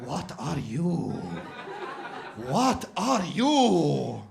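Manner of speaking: very slow, probing "What are you?" in a put-on Turkish accent; suspicious shopkeeper quizzing a customer. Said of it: "But then it starts like".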